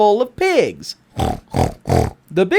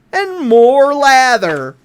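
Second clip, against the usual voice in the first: slow, louder "and more lather"; slow emphatic ending of the list; drawn-out climax; 3 semitones higher, 4 dB louder, slower than usual. A narrator's reading. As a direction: stretch it out as the lather piles up. Growing lather repeated.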